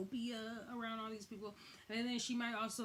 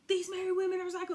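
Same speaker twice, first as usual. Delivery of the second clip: louder, fast, fed up; exasperated rant; 8 semitones higher, 9 dB louder, much faster than usual. Retold or imitated. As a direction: vent it quickly and loudly. Ranting quote from Samantha.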